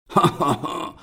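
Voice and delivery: giant's triumphant laugh; gloating laugh. Acted. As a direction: laugh as if he won. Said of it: "rief er lachend".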